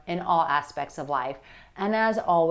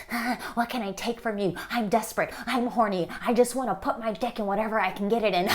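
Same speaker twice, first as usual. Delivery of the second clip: creepy desperate guy; 3 semitones higher, faster than usual. Retold or imitated. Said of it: "the creepy energy is like".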